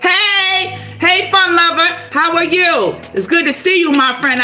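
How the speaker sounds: greeting a fan who joined the stream; cheerful greeting. Real, not acted. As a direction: welcome a viewer warmly and brightly. Greets a viewer joining live.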